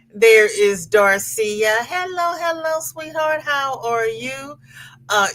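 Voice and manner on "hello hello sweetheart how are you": high sing-song hello. Real, not acted. Greeting another viewer.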